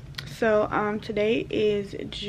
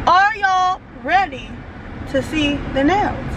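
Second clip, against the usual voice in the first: drawn-out, high-pitched teasing question; playful hype; 7 semitones higher, slower than usual. Real, not acted. Vlogger asking viewers before the nail reveal, playful hype. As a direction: stretch it out like a hype host.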